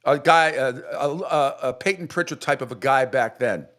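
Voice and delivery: usual speaking voice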